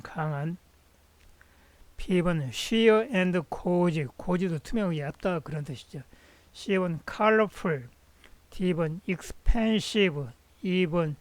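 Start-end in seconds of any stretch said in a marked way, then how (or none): none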